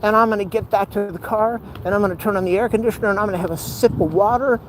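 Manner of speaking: high, run-on whine of resolve; determined pep talk. Real, not acted. Hurting walker vowing to finish, high-pitched resolve.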